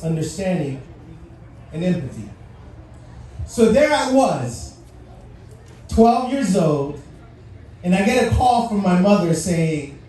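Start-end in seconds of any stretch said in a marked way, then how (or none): 3.5-7.0 slow, weighty storytelling start; slow down to pull them into the story; slow story opener. Real, not acted. Storyteller setting the scene.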